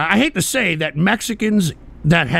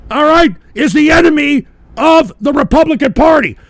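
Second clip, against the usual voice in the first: loud, high, raging yell; screaming outrage at the freeloaders he showed; furious shout; 8 semitones higher, 6 dB louder than usual. Real, not acted. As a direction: tear into them at full volume. Furious rant at welfare recipients.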